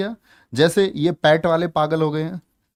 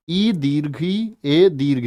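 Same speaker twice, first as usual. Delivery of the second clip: slow vowel demo; slower than usual. Shown as a demonstration. Teacher slowly sounding out vowel.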